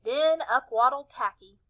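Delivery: usual speaking voice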